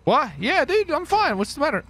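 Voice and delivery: high, chirpy fake casual reply; feigned innocence. Real, not acted. Gamer caught by roommate, faking innocence in a high voice. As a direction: act innocent, too high and bright.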